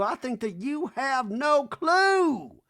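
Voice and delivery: high, loud and very slow. Retold or imitated.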